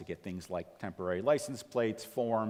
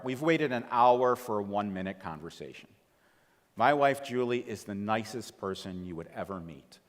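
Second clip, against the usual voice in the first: ordinary talk, nothing marked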